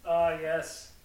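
usual speaking voice

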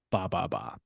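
goat bleat. Pretend. Goat noise after cats will go.

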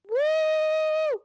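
high loud whoop; excited cheer. Real, not acted. Cheering the episode start.

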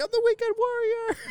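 very high strained mock scream; Weekend Warrior. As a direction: squeal it like a raving partygoer, held back. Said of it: "he's screaming".

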